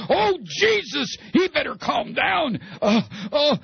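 high, drawn-out panicky whine; scared Democrat. Acted. Democrat reacting to Howard Dean's speech, panicked. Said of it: "This is what has a lot of Democrats".

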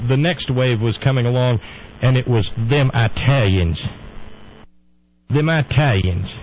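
normal talking voice

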